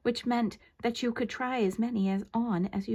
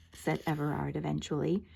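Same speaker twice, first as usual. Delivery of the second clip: usual speaking voice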